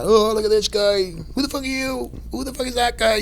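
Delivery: high-pitched imitation of gawking strangers; squeaky-high mock challenge; mock onlookers. Voicing onlookers at the fights.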